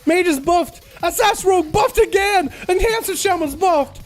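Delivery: gung-ho excitement. Real, not acted. Caster shouting with joy at class buffs, thrilled. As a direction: cheer it out like the patch just made your day. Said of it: "I'm so gung-ho".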